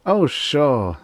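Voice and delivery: put-on high, breezy boy voice; a kid acting big; casual high child bravado; boyish swagger. A narrator's reading. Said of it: "said Tommy largely".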